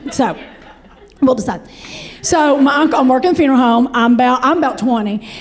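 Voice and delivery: usual speaking voice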